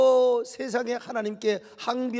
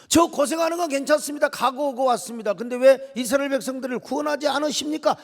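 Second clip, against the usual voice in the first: urgent, higher-pitched pleading challenge; protesting; 5 semitones higher, 4 dB louder than usual. Acted. Moses asking God why Israel suffers, protesting. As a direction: press the question with indignation. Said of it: "하나님 앞에 이제 항변을".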